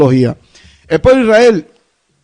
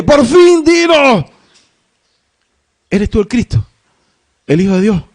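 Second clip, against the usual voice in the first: a touch of an irritated demand, raised pitch; annoyed high priest; 4 semitones higher than usual. Acted. Caifás demanding an answer from Jesus, mildly angry. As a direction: press Jesus, openly annoyed.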